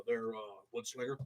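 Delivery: ordinary delivery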